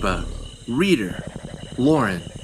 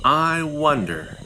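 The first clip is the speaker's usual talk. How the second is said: usual speaking voice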